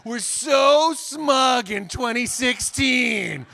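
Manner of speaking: mock gloating schadenfreude; gloating mockery. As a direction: gloat over the smug Dutch, slowly at first. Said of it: "one part of me that was like".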